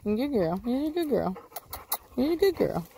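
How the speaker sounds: rising high-pitched praise for the dog; high sing-song praise to a dog. Real, not acted.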